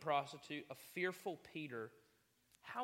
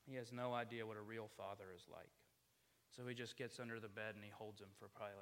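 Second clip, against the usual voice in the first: ordinary delivery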